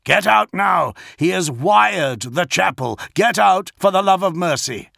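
high, loud, urgent warning; officer yelling at his lords to flee; frantic shout. A narrator's reading. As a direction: shout them out of the trap. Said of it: "Pollux yelled".

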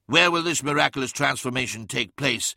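usual speaking voice

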